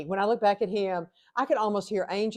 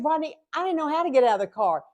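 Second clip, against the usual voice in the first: high, exasperated protest to the husband; 8 semitones higher, 3 dB louder, faster than usual. Retold or imitated. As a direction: whine it up high, fed up. Stuck in car, exasperated at husband.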